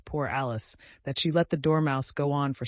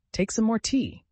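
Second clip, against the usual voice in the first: high, louder earnest urging; earnest, higher invitation; earnest offer; 9 semitones higher, 5 dB louder than usual. A narrator's reading. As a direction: press the tea on her sincerely. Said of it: "very earnestly".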